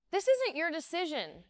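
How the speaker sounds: defiant retort, pitched well up; indignant comeback; shot back. A narrator's reading. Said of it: "I shot back".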